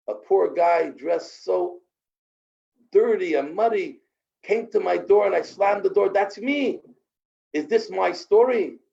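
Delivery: a man horrified to see himself in the story; shocked realization. Acted.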